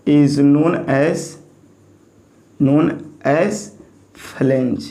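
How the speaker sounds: very slow, each word stretched; teacher dictating a definition word by word; dictation pace. Shown as a demonstration. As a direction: draw out every word as if dictating. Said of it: "horizontal member को बोलेंगे flange".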